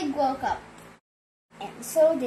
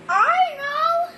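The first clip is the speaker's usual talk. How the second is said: much higher, louder little cat; cat's voice; 14 semitones higher, 6 dB louder than usual. Acted. Cat jumping in with an idea, high and loud. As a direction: pipe it up brightly as the cat. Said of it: "said cat".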